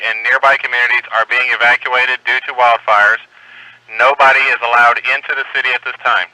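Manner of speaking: steady, formal radio announcement; emergency bulletin read. Real, not acted. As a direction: read the advisory clearly over the air. Reads emergency bulletin on air.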